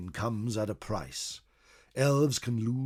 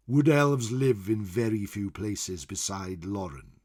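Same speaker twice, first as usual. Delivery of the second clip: usual speaking voice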